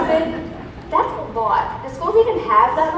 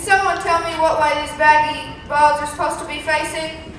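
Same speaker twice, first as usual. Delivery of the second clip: drill sergeant. Acted. Drill sergeant inspecting troops.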